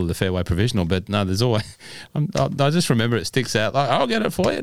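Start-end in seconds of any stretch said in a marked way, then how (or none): 3.9-4.6 high squeaky imitation of an eager mate; silly helper voice. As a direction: pipe it up like a keen golf buddy. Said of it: "I don't know why I'm doing that voice".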